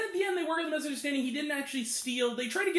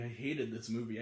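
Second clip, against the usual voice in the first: much lower and softer, muttered in disgust; 14 semitones lower, 8 dB quieter than usual. Shown as a demonstration. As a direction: mutter it like a grim confession. Repeated verdict, second take.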